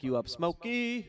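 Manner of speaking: movie quote voice. Retold or imitated. Quoting a movie character.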